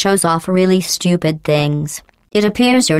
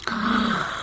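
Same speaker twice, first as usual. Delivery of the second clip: soft, higher, falling gasp of fake surprise; mock gasp; 6 semitones higher, 10 dB quieter than usual. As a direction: gasp like you missed it, not meaning it. Feigning shock at missing something.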